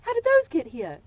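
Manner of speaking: put-on a playful British accent, teasing her kid; mock British accent. Mom teasing her daughter in a little British accent. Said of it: "In her little British accent".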